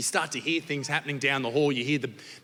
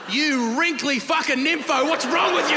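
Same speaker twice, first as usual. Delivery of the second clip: mock outraged yell; 8 semitones higher, 4 dB louder than usual. Boy yelling at his grandparents in disgust, mock horror.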